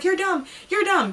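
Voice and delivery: high-pitched imitation of a scolding friend; mock yelling. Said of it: "yelling at me".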